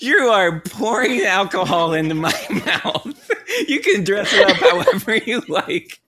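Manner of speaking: laughing, high and loud; giggly drunk. Acted. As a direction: giggle through the words. GM laughing in character.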